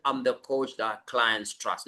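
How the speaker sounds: tossed off softly, without belief; weak half-hearted version. Shown as a demonstration. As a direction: mumble it like you don't believe it. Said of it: "So you're not just saying".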